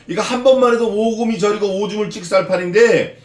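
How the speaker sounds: ordinary delivery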